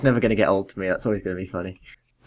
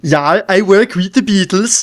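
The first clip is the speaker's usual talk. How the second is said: slow, mock Scandinavian accent, higher and louder; 6 semitones higher, 4 dB louder than usual. Podcaster mocks his co-host with a Scandinavian accent.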